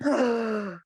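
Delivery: a sharp, hammed-up gasp of shock; mock shocked gasp. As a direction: gasp as if struck by the question. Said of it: "And I was like".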